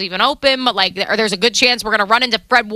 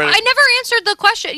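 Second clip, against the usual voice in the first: high excited protest; 11 semitones higher, faster than usual. Acted. Mock outrage at co-host.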